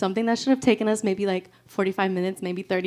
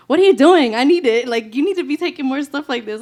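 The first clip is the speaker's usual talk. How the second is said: louder, pushy friend; urging friend; 3 semitones higher, 7 dB louder than usual. Retold or imitated. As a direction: insist like a friend scolding you. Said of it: "She's like".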